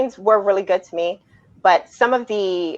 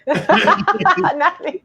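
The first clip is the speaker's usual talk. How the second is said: laughing; 11 semitones higher than usual. Real, not acted. Laughs before answering.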